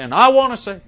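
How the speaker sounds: high, loud, stretched-out oratory; hammed-up mock civil rights preacher; Martin Luther King. Host imitates a speech given in a church, mock rousing orator. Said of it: "he'd give his speech and he'd say".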